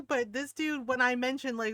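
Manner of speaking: ordinary delivery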